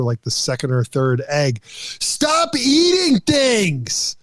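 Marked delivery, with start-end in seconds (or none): 1.6-4.2 put-on high, drawn-out kid's yell at the TV; mock yell in a high, slow child voice; son yelling at screen. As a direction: imitate a worked-up son, pitched way up, stretching each word. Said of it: "my son yelling at the screen".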